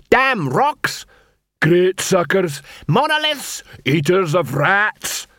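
dwarf insults. Acted. Dwarfs insulting the trolls, high jeering shouts.

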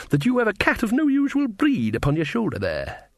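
high, rustic character voice; knowing, high-pitched countrywoman; observant cook figure. Acted. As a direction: pronounce a shrewd observation in a high put-on voice. Mrs Pleasant's dialogue.

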